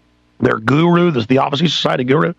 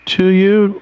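ordinary talk, nothing marked

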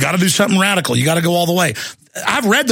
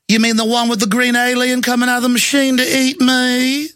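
mock Georgia accent; 6 semitones higher than usual. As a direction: imitate a fake Georgia accent sneering. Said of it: "in his fake Georgia accent".

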